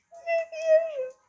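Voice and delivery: high falsetto disbelief; squeaky, slow 'no way'; mock disbelief. Disbelief at the game.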